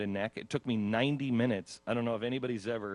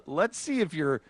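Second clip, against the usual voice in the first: higher, lightly sing-song tester; mock chirpy examiner; imitating medical staff; 7 semitones higher, 4 dB louder than usual. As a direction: play the staff cheerfully trying something on a patient. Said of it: "and going".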